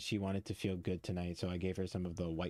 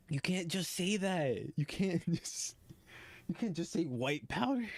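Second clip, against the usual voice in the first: shocked disbelief; 8 semitones higher than usual. Real, not acted. Streamer to the character, high and stunned. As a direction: stretch it out in disbelief. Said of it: "streamer reacts to line".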